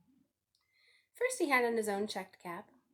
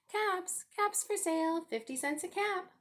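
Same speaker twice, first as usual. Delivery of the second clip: high sing-song peddler's call; calling out wares; 10 semitones higher than usual. A narrator's reading. Peddler calling to the street, sing-song call. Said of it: "walked down the street calling".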